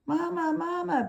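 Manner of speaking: very high, tiny chick calling for mama; peeping gosling. Acted. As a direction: peep like a baby bird crying for its mother. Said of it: "peeped the gosling".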